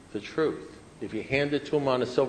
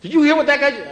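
very high, loud outraged squawk; agitated sponsee; 10 dB louder, faster than usual. Retold or imitated. Sponsee demands he share the outrage, imitated agitation. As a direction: mimic a scandalized friend, voice shooting up.

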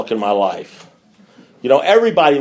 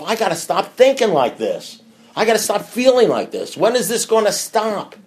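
anxious self-talk; 7 semitones higher than usual. Retold or imitated. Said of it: "You may even say to yourself".

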